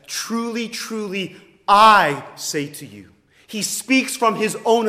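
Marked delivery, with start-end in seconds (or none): 0.1-3.1 first "truly" lifted higher, "I say to you" much louder; authoritative declaration. Retold or imitated. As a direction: speak it as Jesus declaring on his own authority. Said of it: "he says".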